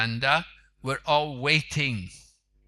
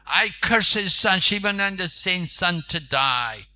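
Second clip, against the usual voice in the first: loud, higher furious curse; angry curse; 3 semitones higher, 4 dB louder than usual. Acted. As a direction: spit the curse out in rage. Lord curses in anger.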